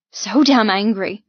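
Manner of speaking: plain reading voice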